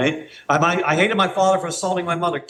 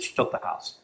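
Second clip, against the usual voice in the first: whispered; 9 dB quieter than usual. Retold or imitated. Man whispering to friends that his wife took the house. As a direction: whisper it, hollow and defeated. Mournful self-pity quote.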